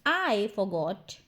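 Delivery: "I forgot" slowed, with a long "I"; slow repeat. Shown as a demonstration. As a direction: draw out "I", then say "forgot". ("I forgot" धीरे, "I" को खींचकर)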